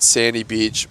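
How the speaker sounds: ordinary talk, nothing marked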